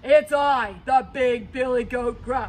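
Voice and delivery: loud hoarse big goat; big goat answering the troll, loud and hoarse; ugly hoarse voice. Acted. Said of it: "ugly hoarse voice of his own".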